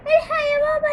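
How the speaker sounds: loud tearful frightened plea; crying for help. Acted. Daughter crying to her father about ghosts, tearful loud plea. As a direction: sob out to dad for help. Girl calls dad for help.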